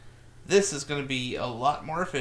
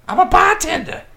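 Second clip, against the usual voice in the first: much higher, louder tough-guy voice; 12 dB louder than usual. Acted. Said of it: "It's a horrible impression".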